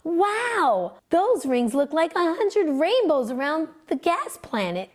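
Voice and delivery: light up with wonder at the rings; Joe's amazed thought. A narrator's reading. Boy marvelling at Saturn's rings, excited. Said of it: "he thought".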